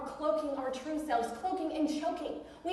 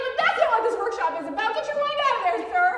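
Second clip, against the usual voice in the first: much higher, louder mock-scolding; teasing scold; 9 semitones higher, 9 dB louder than usual. Speaker scolds a smirking audience member, loud teasing. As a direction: playfully scold the dirty-minded guy.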